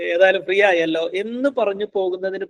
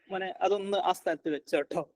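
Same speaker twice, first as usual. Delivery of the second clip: much softer model of a kind instruction; gentle parental request; 10 dB quieter than usual. Shown as a demonstration. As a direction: softly coax a child. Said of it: "എന്നാ പറയേണ്ടത്".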